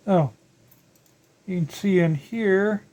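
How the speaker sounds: normal talking voice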